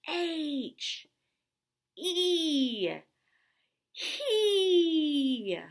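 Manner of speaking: high, loud flexing shout of each letter; tough muscle voice. Shown as a demonstration. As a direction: pump up your muscles and belt it out. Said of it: "we're gonna get our muscles out".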